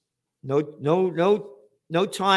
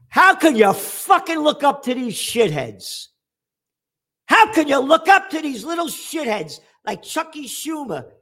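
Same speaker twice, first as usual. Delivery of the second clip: shouting, much higher and louder; furious shout; 9 semitones higher, 6 dB louder than usual. Real, not acted. Host rails against politicians like Schumer, furious shout. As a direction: yell it in outrage at the politicians.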